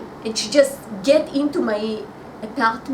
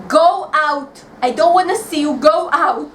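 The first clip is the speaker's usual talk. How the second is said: loud, raised dismissal; angry shout; 2 semitones higher, 6 dB louder than usual. Acted. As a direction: shout her out of the house. Throws the rival out.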